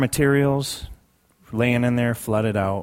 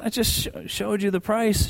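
exasperated protest; 9 semitones higher, 4 dB quieter than usual. Retold or imitated. Retold protest at clerk.

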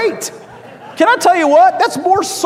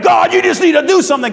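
high, loud mock plea to heaven; demanding prayer; 8 semitones higher, 6 dB louder than usual. People praying for God to step in, mock demanding shout. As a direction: shout it upward as an impatient demand. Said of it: "we're praying".

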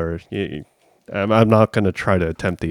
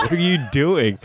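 puzzled mother; 6 semitones higher than usual. Retold or imitated. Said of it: "looking at me like".

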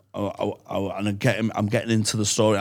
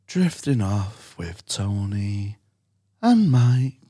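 ASMR voice; slower than usual. Shown as a demonstration. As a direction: lull her to sleep slowly. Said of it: "So I just do that A S M R voice".

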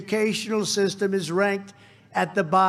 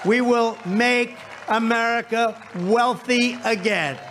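shouted rally climax; 3 semitones higher than usual. Real, not acted. Rally climax, crowd chant lines.

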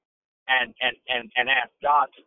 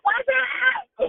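high squeal. Real, not acted. Playful greeting going higher.

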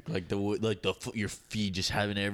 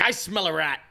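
suspicious; 10 semitones higher, 6 dB louder than usual. Retold or imitated. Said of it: "In person, I'm like".

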